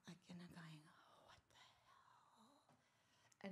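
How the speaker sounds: whispered, fast and hushed. Real, not acted. Telling the friend how the police arrived, whispering. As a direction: drop to a tense whisper.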